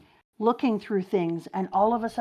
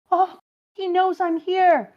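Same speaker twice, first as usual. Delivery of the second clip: much higher, louder alarmed gasp; startled Nami; 12 semitones higher, 6 dB louder than usual. Acted. Nami hiding behind a rock, high startled cry. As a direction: yelp it in panic. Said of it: "says Nami".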